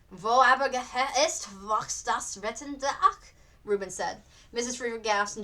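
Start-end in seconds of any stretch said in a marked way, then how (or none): 0.1-3.3 quoted German verse, a touch louder; German line. Acted. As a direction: recite the German poem line. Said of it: "It's just a line from a poem".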